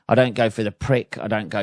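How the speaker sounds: normal talking voice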